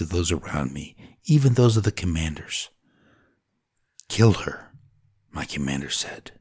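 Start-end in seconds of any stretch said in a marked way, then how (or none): none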